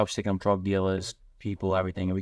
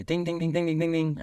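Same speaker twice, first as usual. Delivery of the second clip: high, rapid-fire dinging; high-pitched ding-dong imitation; imitated doorbell; 8 semitones higher than usual. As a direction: mimic a doorbell ringing over and over.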